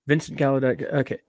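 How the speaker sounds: ordinary talk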